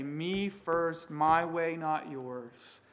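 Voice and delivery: ordinary delivery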